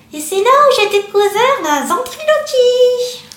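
high voice with a closed mouth; ventriloquist voice. Real, not acted. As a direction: speak without moving your lips. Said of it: "de la bouche totalement fermée".

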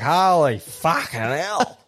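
drawn-out, slow amazed exclaim; mock amazed workmates. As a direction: stretch it out in disbelief. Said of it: "And they're like".

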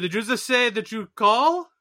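put-on high, slow incredulous accent; mock disbelief. Reenacting the Rounders scene, slow incredulous put-on voice. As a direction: stretch it out in disbelief.

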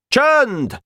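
very high cry for silence; high, sudden general's command; commanding shout. A narrator's reading. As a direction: call the troops to order. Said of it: "kiáltott a tábornok".

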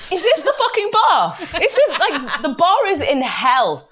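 laughing, exasperated disbelief; incredulous outburst. Retold or imitated. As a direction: cry it out high, appalled and amused. Her incredulous thought at low standards.

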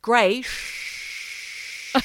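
"Grey" trailing into a long "shh"; "Grey, shh" with the end hissed like running water; hissing word ending. Shown as a demonstration. Co-host showing how Australians say it, "Grey" ending in shower hiss. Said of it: "like the shower's just been turned on".

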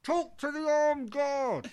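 loud, slow, high dismissive yell; mock shout. Telling John Paul to talk to guards, shouted. Gatekeeper voiced in story.

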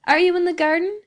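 put-on high, loud young woman's call; rich youthful cry. A narrator's reading. Daughter calling from the window to her father, rich youthful cry. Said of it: "cried a rich and youthful voice".

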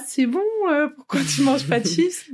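high, puzzled friend. Retold or imitated. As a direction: ask it high and baffled. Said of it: "j'étais là-bas".

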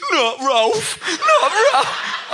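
high, loud wailing "not Ralph"; high laughing wail of despair; mock anguished wail. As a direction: ham up a heartbroken fan's cry, laughing. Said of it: "All he just kept on going is".

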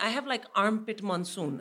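ordinary talk, nothing marked

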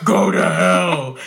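slow, low exaggerated growl; low mock villain voice. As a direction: drop the voice low and drag it.

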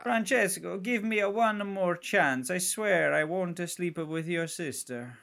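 high, slow mock Italian pleading; hammed-up Italian accent. Co-host doing Jared Leto, pleading in hammed-up Italian accent. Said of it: "trying to do an Italian accent".

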